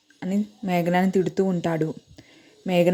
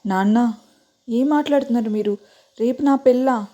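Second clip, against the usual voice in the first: shocked; 5 semitones higher, 4 dB louder than usual. A narrator's reading. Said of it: "మేఘన shock అయింది".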